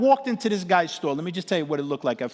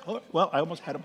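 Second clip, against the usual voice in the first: ordinary delivery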